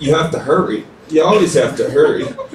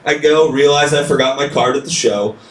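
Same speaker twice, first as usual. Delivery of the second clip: ordinary delivery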